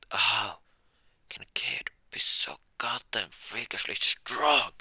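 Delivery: loud, slow, building disbelief; incredulous outburst. Acted. Drunk slammed to the ground by a kid, incredulous outburst. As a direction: stretch it out, rising to a strained yell.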